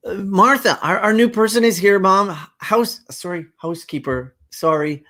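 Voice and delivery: high, loud, tumbling over words; a child rushing to introduce; excited young girl. Acted. Queenie introduces Martha to mom.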